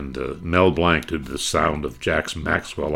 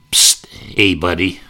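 Sheldon Leonard hustler; 4 dB louder, slower than usual. Retold or imitated. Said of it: "Sheldon Leonard".

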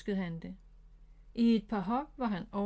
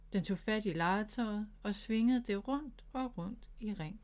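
ordinary delivery

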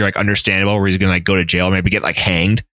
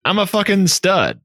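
high-pitched, indignant bragging; mock wounded boast; 8 semitones higher than usual. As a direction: protest your studliness in a higher voice. Rejected man protesting, acted out.

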